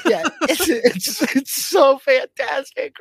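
delighted gush. Real, not acted. Gushing over Cleopatra's reaction.

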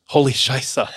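"Holy Scheiße" with a light German accent on Scheiße; German swap-in. Shown as a demonstration. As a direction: say "Scheiße" like a German would, half-joking. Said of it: "In German".

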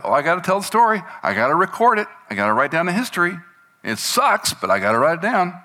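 high, loud mock grumbling; mock grumble. The weary recorder complains about writing history, in high mock grumbling. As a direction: groan out the chore of writing it down.

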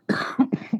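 harsh hacking cough; rough smoker's hack. Real, not acted. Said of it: "Smoker's cough".

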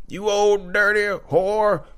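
mock raging old man. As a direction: play a cranky old man spitting out an insult.

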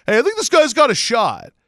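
high, loud mock enthusiasm; hyped-up fans. Fan mimicking people excited about a new quarterback, hyped up. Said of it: "really hyped up".

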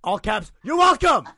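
loud, high shouted text; all caps shout. Retold or imitated. Reading the text message aloud in all caps. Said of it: "in your last all caps message".

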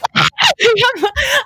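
high-pitched mock scream; retold scream. Jumping up after the spider hit, screaming. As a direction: let out a high shriek, acting out panic. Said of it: "Now, I'm screaming. I get up like".

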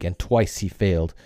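usual speaking voice

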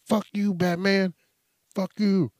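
high, loud retold shouting; yelling drug dealers. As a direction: hurl it back at the intruder. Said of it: "And they were like".

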